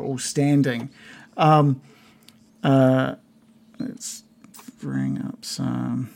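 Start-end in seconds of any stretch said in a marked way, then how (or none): none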